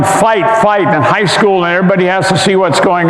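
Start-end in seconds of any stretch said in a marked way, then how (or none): none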